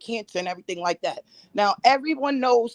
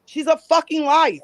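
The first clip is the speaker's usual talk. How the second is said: heated, raised-pitch accusation; angry outburst; 2 semitones lower than usual. Real, not acted. Guest telling her accuser to stop talking about her, angry outburst. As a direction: spit out the accusation, fed up.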